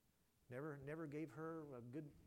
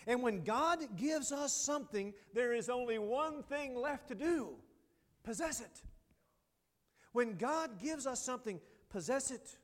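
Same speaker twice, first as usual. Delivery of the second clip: loud, slow, rising preacher's cry; preaching at full force; 9 semitones higher, 10 dB louder, slower than usual. Acted. Preacher proclaiming to the church, loud and slow. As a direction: drive the promise home to the congregation.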